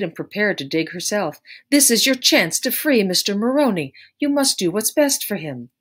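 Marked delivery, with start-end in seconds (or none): 1.7-5.7 high, loud insistence; forceful urging. A narrator's reading. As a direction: push them hard to act now. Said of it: "Kate was no longer mild".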